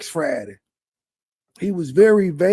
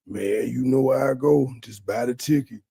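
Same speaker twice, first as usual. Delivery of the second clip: low, lazy mocking voice, dropping lower; mock dopey quote; 7 semitones lower than usual. Commentator parodying the streamer's cruise plea, low and mocking. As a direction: parody the guy begging to come along, low and dull. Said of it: "What kind of man says to him".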